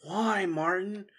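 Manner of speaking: high, slow anguished plea; plaintive, pleading cry; tearful frustration. A narrator's reading. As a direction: draw out a despairing question. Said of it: "a tear of frustration".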